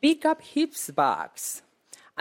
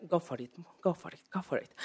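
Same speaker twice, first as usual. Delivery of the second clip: quiet fast self pep talk; 11 dB quieter, much faster than usual. Retold or imitated. Hushed self pep talk on plane.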